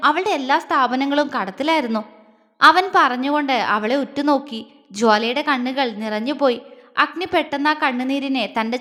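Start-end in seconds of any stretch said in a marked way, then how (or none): none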